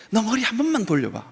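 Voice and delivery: understated seriousness; serious doctor. Retold or imitated. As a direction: imitate a doctor asking gravely. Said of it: "의사가 진지하게 얘기해요".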